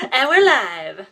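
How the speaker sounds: loud, high showy announcement; excited announcer. Real, not acted. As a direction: call it out like a host. Announcing the live stream.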